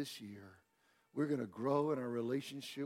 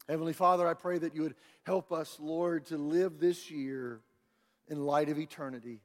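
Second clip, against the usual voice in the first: higher, louder, impassioned pleading; fervent prayer; 6 semitones higher, 6 dB louder than usual. Real, not acted. Preacher prays for the congregation's year, fervent and building. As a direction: build the prayer to a cry, then ease off.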